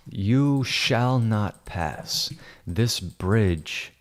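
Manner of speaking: very slow, drawn-out wizard proclamation; mock wizard command. Editor playing wizard blocks Amy at bridge, grand command. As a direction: stretch each word like a pretend wizard.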